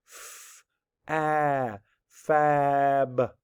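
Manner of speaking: F as a bare hiss, A held, then FAB stretched; sounding out. Shown as a demonstration. Teacher sounding out a word, stretched sounds blended. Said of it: "you absolutely can sound it out".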